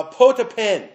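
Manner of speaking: "potapen" said slowly as POH-tah-pen, stress on the first syllable, falling; Greek word said clearly. Shown as a demonstration. Preacher teaches the congregation a Greek word, spelled out carefully. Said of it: "here's a transliteration for you".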